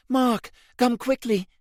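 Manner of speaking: high, urgent call across the boat. A narrator's reading. Rose calls Mark urgently from the deck, high and alarmed. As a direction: call him over fast, alarmed. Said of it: "then Rose called".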